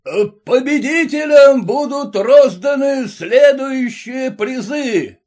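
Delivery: loud, high ringmaster cry; announcer shout. A narrator's reading. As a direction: force the prize announcement out. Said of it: "и крикнул".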